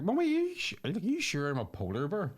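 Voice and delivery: high squeaky "Mummy", easing back down; baby polar bear. Acted. Baby polar bear asking mummy if it's really one, high childlike questioning. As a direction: play a doubting cub, starting very high. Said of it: "baby polar bear's like".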